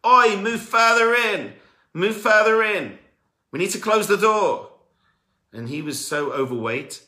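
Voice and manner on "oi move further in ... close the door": loud, high, slow barked orders; guard screaming. Retold or imitated. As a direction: yell it down the line like a guard. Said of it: "starts screaming at him".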